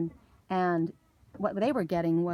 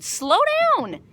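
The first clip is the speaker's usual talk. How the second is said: high, louder otherworldly voice commanding; voice in my head; 7 dB louder than usual. Retold or imitated. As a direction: sing out the spirit's warning from above. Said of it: "heard in my head".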